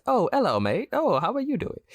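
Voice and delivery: high-pitched, hammed-up British greeting; mock British accent. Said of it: "And then once camera cuts".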